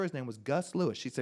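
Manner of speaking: ordinary delivery, nothing marked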